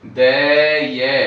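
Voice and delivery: loud, slow syllable-by-syllable दे ये; slow spelled syllables. Shown as a demonstration. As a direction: spell out दे then ये for the class. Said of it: "दे ये का दे हो जाएगा".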